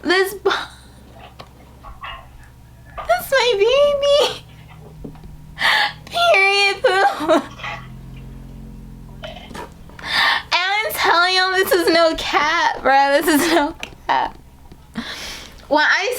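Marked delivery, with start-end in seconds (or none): 0.0-7.4 high, broken, crying voice; choked up showing the box; tearful joy. Real, not acted. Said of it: "I'm getting so emotional". 9.2-14.4 teary. Real, not acted. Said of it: "I'm getting so emotional".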